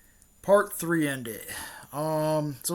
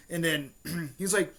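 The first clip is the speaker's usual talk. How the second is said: ordinary talk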